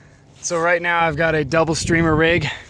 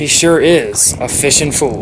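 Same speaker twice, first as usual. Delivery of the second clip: announcer; 6 dB louder, slower than usual. Acted.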